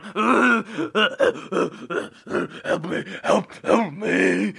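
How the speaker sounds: hammed-up dying pilot. Pilot crawling from the wreck, loud strained help cries. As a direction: plead like a crushed pilot in the wreck. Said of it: "he's going".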